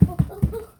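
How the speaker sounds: startled oh oh as balance slips; losing balance, alarmed; wobbling exclamation. Real, not acted. Losing balance while demonstrating.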